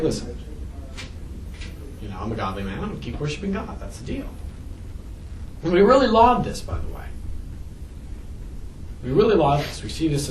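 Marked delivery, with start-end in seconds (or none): 0.0-4.3 much softer, calm and settled; a hint of Job quietly holding firm; quiet steady resolve. Job explaining to his wife, quiet and settled. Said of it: "Job's like".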